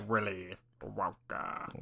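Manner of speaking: very slow, low, softened British drawl; Alan Rickman. Retold or imitated. Last of three names in a roast bit, slow Alan Rickman impression. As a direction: stretch each word out with dry British gravity. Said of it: "in the perfect Alan Rickman voice".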